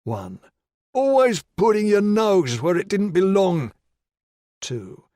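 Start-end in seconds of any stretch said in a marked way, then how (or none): 0.9-3.7 high, loud sneering threat; menacing attacker. Acted. Attacker taunting the helpless man, loud sneering threat. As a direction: taunt him like you hold his life.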